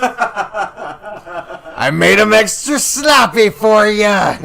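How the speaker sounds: high, loud, slow hammed-up lunch lady. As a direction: dish it out like a cartoon cafeteria lady. Playing the sloppy joe lunch lady.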